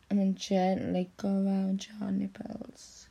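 breathy intimate whisper; hushed whispered instruction. Acted. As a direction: whisper it close in his ear.

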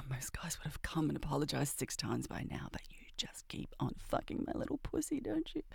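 low, intimate breathy whisper; seductive whisper. Acted. She whispers demands to her lover. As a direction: whisper it close to the ear.

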